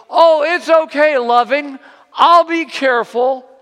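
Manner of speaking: put-on high, loud carefree kid's shout; boy shouting back. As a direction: holler it like a confident child. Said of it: "And he goes".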